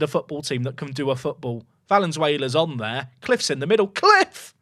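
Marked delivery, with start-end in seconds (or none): 3.9-4.5 high, loud cry of the name; excited shout. Real, not acted. Streamer celebrating a goal, loud high shout. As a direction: yell the scorer's name in joy. Calling the scorer.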